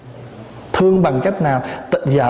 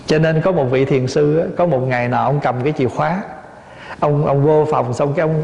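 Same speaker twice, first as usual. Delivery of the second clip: usual speaking voice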